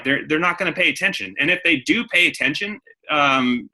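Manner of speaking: ordinary talk, nothing marked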